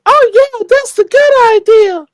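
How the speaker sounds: exaggerated high-pitched imitation; high girly voice. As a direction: play it bright and eager. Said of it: "she's like".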